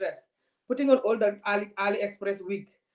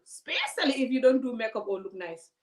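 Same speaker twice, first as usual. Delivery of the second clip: ordinary delivery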